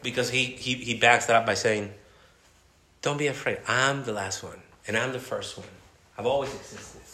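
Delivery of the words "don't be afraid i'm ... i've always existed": gentle comfort. Acted. Voicing Jesus's comfort.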